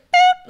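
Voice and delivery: a sudden, very high, loud "Boop"; cartoonish boop sound effect; mock boop. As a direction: pop the sound out like a toy. Mom tossing baby over border.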